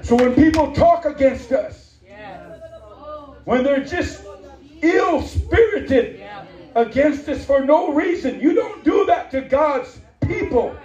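high, loud, slow shouted sermon with laughs; preaching at full force. Acted. Preacher exhorting the congregation about vengeance, full-force preaching. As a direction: build it to a ringing climax.

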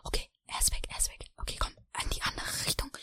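unvoiced hiding whisper. Hiding sprayer whispering to his friend that the cop is gone. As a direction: whisper so the cop doesn't hear. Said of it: "ich bin leise".

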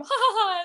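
high, put-on ha-ha-ha laugh; playful, hammed-up spoken laugh; flirty mock laugh. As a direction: imitate giggly flirting laughter. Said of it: "they're flirting and laughing".

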